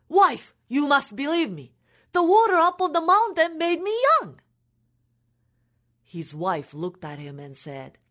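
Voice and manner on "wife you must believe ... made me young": urgent excitement. Acted. Urgently convincing wife.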